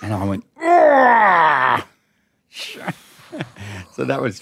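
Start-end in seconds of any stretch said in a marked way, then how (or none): 0.6-1.9 high, loud, hammed-up shriek of horror; mock horrified cry. Dad shrieking in mock horror at the kid's plans. Dad's reaction to Hugo.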